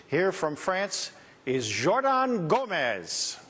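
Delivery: slow, high showman introduction; stage announcer. Real, not acted. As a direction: bring on the act with a flourish. Host introduces the next act.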